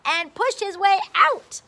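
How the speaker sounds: high, loud and fast; excited storytelling. A narrator's reading. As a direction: build up to the big reveal. Climax of story reading.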